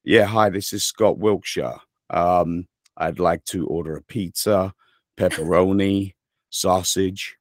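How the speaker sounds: easy, unforced American accent. Shown as a demonstration. Ordering a pizza to show he can sound American, casual American accent. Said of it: "I can kinda sound American".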